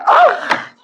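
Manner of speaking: high squealed ah of delight; mock swoon. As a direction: squeal like a smitten wife. Wife swooning again.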